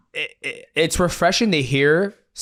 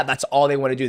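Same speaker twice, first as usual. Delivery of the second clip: normal talking voice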